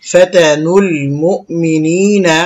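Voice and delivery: slow, drawn-out tajweed chant; Quran recitation. Shown as a demonstration. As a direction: stretch the long vowels evenly. Recites the phrase just spelled out.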